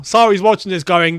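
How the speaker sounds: ordinary delivery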